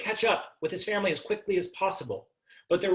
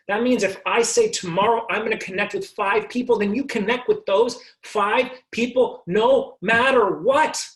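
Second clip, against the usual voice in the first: louder and higher toward "what"; building to a shout; 6 semitones higher, 6 dB louder than usual. Real, not acted. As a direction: push it up to a rousing peak. Rising motivational push.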